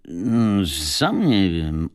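very slow, doubtful drawl; hesitant, baffled reply; puzzled. A narrator's reading. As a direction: draw it out, unsure. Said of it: "odparł zdumiony sprzedawca".

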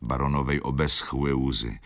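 ordinary delivery, nothing marked

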